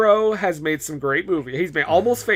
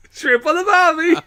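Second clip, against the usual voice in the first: much higher laughing Aussie voice; mock Australian; 12 semitones higher, 4 dB louder than usual. Host riffing Aussie clichés, high laughing mock accent. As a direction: chirp the old ad line in a silly accent.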